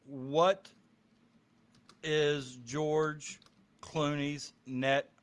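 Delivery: slow, word by word with gaps; typing along. Real, not acted. Typing a search for the chat, halting word by word. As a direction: space the words out as you type them. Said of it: "I typed in".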